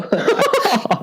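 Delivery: sudden high-pitched laugh; high burst of laughter. Real, not acted. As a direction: crack up at the pun, squealing. Laughing at the pun joke.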